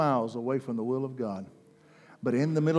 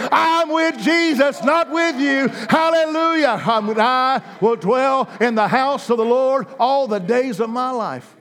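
higher and louder, building preaching; rising preaching climax; 9 semitones higher, 9 dB louder than usual. Acted. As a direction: climb to a shouting climax.

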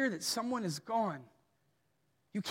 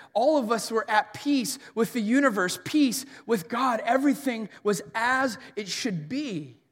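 louder, driving sermon voice; preaching at full force; 7 dB louder than usual. Acted. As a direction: preach it out to the congregation. Preaching builds.

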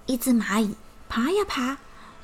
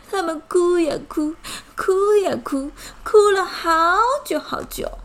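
mock sobbing narration; 7 semitones higher, 5 dB louder than usual. A narrator's reading. Narrator acts out their crying.

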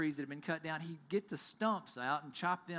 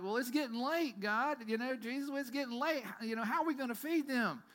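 raised, pleading voice of the disciples; worried disciples; 7 semitones higher than usual. Retold or imitated. Disciples fretting to Jesus about feeding crowd, worried pleading.

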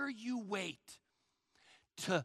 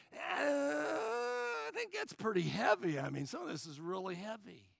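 acted reluctance; 4 dB quieter than usual. Believer reacting to God's commands, hammed-up reluctance. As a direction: balk at it with a stretched-out groan of doubt.

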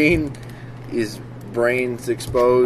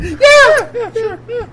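high, strained yeah yeah; strangled high yelp; 9 dB louder, slower than usual. Retold or imitated. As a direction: squeak it out as if grabbed. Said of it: "like someone just grabbed his balls".

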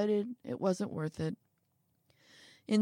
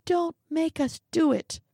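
high pleading voice; 9 semitones higher, 3 dB louder than usual. Acted. Little man pleads and warns.

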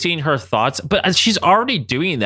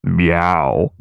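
very low, deadpan cat meow; imitating Sabretooth; 11 semitones lower than usual. Host retelling the line that made them laugh, low mock meow. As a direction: drop deep for one spoken meow. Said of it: "Sabretooth just goes".

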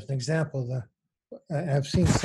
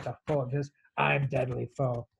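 tough cowboy reading. Acted. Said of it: "That's a John Wayne line".

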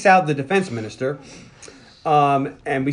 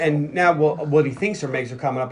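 ordinary talk, nothing marked

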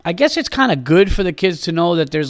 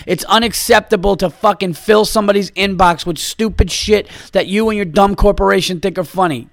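normal talking voice

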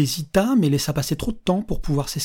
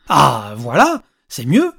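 put-on pleased old man's voice leaping up; voice climbing in pitch; 7 dB louder, much slower than usual. A narrator's reading. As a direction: exclaim approval, swooping upward. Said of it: "avait grappillé quelques octaves".

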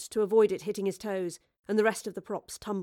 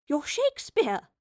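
higher, slow amazed exclamation; starstruck exclaim; 7 semitones higher, 4 dB louder, slower than usual. Acted. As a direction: marvel at who he is. Starstruck recognition.

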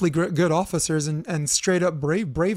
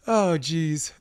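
ordinary talk, nothing marked

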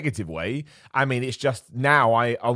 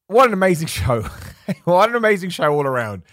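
laughing; 5 semitones higher, 5 dB louder than usual. Real, not acted.

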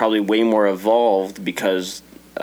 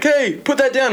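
cop shouting. Retold or imitated. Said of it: "be like".

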